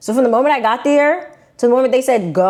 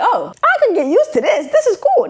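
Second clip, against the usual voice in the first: delighted; 12 semitones higher than usual. Retold or imitated. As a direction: voice jumping much higher on "cool". Delighted by the warm welcome.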